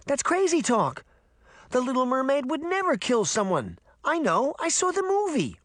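put-on very high, indignant little girl; high-pitched kid in disbelief; child crying out. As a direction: protest like an outraged kid. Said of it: "Daphne cried".

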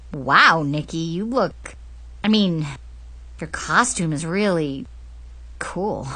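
put-on low, halting boyish voice; hesitant compliment. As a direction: grope for the compliment, with awkward pauses. Boy haltingly compliments her costume.

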